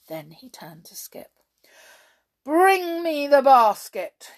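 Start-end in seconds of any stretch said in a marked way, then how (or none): 2.4-4.3 loud, slow, high command; ordering. A narrator's reading. Hop ordering Skip about, loud command. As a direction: bark the order at the servant. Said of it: "he ordered".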